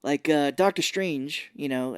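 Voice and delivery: normal talking voice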